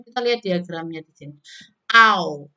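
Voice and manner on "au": loud Au with rounded lips. Shown as a demonstration. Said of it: "make lips rounded". (Au dengan bibir bundar)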